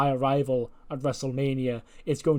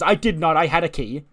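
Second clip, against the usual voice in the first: louder, higher defensive retort; indignant denial; 3 semitones higher, 6 dB louder than usual. Acted. Candice answering Johnny's accusation, indignantly. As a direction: protest the accusation, pitched up and forceful.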